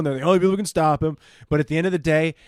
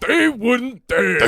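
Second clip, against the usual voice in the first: bad Al Pacino; 7 semitones higher, 4 dB louder, much slower than usual. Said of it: "launch more bad Pacino".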